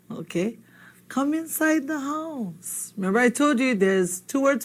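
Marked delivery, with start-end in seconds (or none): 1.1-2.9 slow prompt. Shown as a demonstration. Slow prompt of the line to translate.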